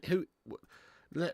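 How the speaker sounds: ordinary talk, nothing marked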